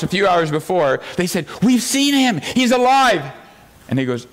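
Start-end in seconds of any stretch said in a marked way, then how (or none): 1.6-3.2 excited news. Retold or imitated. Disciples telling Thomas excitedly.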